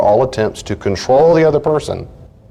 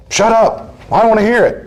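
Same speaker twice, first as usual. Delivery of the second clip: high-pitched, exasperated shut-down; mock angry silencing; 12 semitones higher than usual. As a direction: act out cutting someone off, voice jumping high. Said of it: "Suppress their expressions of feelings".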